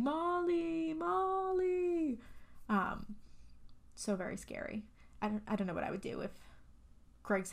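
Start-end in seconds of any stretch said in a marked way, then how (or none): 0.0-2.2 high, drawn-out calling of a name; wailing mock yell without much force. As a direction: imitate a distant yell, each word stretched long. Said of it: "it starts yelling".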